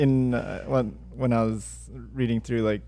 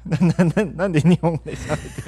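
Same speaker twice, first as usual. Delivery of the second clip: loud, higher stern teacher; 5 semitones higher, 6 dB louder than usual. Pretend. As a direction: play a strict Japanese teacher. Teacher role play scolding.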